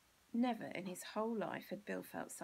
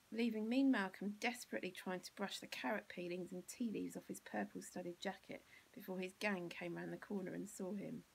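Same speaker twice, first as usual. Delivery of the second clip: ordinary delivery, nothing marked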